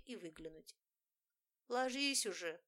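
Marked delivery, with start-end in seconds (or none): 1.7-2.6 sleepy mumble. A narrator's reading. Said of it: "сонно пробормотала я".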